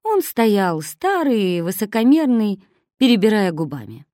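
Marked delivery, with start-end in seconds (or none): none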